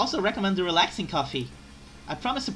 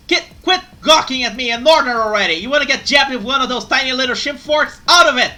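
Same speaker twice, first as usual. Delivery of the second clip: furious shouting, much higher; angry shouting maid; 7 semitones higher, 12 dB louder than usual. Acted. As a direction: yell at the customer. Maid turns to angry discipline act.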